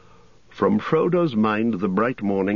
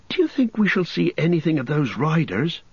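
cheerful; 9 semitones higher than usual. A narrator's reading. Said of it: "asked Pippin cheerfully".

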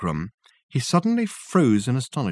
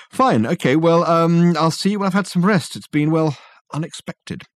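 higher, halting, fading on the last word; flustered Richard; 7 semitones higher, 5 dB louder than usual. Acted. Richard leaving, still flustered and tired. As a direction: stumble for words.

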